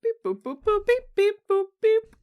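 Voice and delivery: high beeping supercomputer noises; computer noise. Pretend. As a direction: beep and boop like an old computer. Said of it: "Computer noise".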